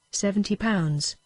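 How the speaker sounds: plain reading voice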